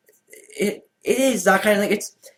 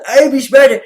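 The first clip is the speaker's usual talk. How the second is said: loud, higher caveman boast; a dim brute bragging; imitating a gorilla-armed idiot; mock dumb voice; 6 semitones higher, 9 dB louder than usual. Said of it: "what he's doing is when".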